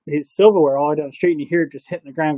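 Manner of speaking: normal talking voice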